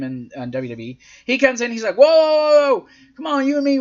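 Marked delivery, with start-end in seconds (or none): 2.0-3.8 high, loud "hey, hold on" appeal; pleading cousin. Retold or imitated. Host plays the cousin entering the ring, pleading. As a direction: talk him out of it, pitched up.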